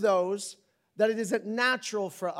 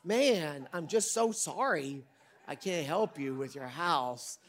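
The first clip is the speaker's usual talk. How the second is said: mock regret; 6 dB louder, slower than usual. Pastor mocking his fake regret to the congregation, hammed-up. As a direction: overplay the fake sorrow, then drag it out. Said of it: "So I'm like".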